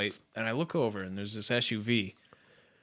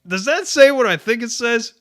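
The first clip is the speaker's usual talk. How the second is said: incredulous; 12 semitones higher, 11 dB louder than usual. Retold or imitated. Retold incredulous question to Ryan.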